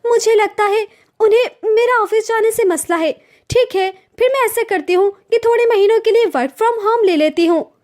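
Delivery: a wounded daughter-in-law venting to her husband; young wife complaining. Acted. Sonam voice again.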